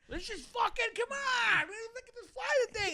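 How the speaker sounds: high, slow, whiny outraged passenger; exaggerated high-pitched complainer impression. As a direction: mimic an entitled traveler griping about the delay.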